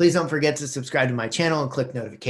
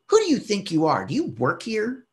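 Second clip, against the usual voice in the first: higher, indignant retold demand; mock angry shopper; 8 semitones higher than usual. The shopper snaps at the vlogger in a mock huffy voice. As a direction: imitate her huffy challenge.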